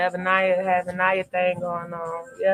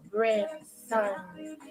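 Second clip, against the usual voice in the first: savoring the name; 6 dB quieter, much slower than usual. Shown as a demonstration. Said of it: "The way it rolls off the tongue".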